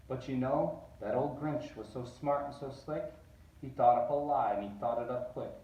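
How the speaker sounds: usual speaking voice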